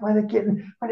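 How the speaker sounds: ordinary delivery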